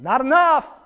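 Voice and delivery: a lightly voiced wife calling out that it's not enough; nagging wife. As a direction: play the wife scolding him from the pews. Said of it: "She's probably going".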